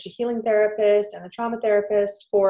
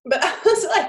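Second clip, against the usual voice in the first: laughing; 8 semitones higher, 5 dB louder than usual. Real, not acted. Laughing while retelling embarrassment.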